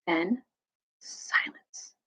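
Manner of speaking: whispered, suspenseful; hushed. A narrator's reading. Narrator marks the end of the fight, hushed. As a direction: drop away into a whisper.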